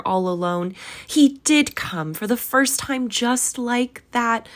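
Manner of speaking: high-pitched, gushing recognition; ecstatic. A narrator's reading. Scrooge recognises his boyhood storybook hero, ecstatic. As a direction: pour it out high with childlike delight. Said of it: "Scrooge exclaimed in ecstasy".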